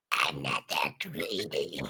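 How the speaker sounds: low, slow redo. Acted. Player corrects their character's line, low and drawn-out. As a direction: drop right down and drag it out.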